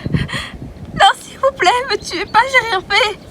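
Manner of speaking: high frightened begging; high desperate plea; terrified pleading. Acted. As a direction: plead for your life, panicking. Captive begs for her life.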